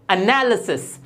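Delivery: एनालिसिस as "uh-NAL-uh-sis"; correct pronunciation. Shown as a demonstration. Teacher models for learners, एनालिसिस said "uh-nal-uh-sis". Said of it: "ये होता है".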